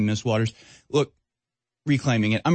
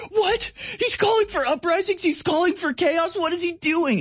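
much higher, hammed-up shrieking alarm; mock outrage. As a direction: squeal it like a panicked liberal. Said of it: "There's like".

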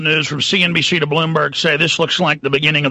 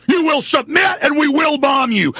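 higher, shouting threats like a cartoon tyrant; mock tyrant shout; 9 semitones higher than usual. Host voicing US rulers at Iran, raging threat. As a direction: bark the threat as the bully empire.

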